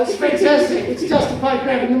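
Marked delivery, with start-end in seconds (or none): 0.0-0.8 thrilled. Acted. Thrilled to have a Cockney.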